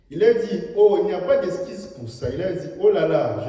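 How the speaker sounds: loud, high fervent sermon delivery; full-force preaching. Acted. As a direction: preach it out to the hall. Preacher retells critic scene.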